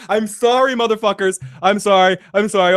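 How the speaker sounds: ordinary delivery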